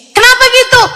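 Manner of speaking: high-pitched, baffled question; puzzled companion. Acted. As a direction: ask why, pitch jumping right up. Acted companion questioning Ibnu Umar. (bertanya heran dengan nada tinggi)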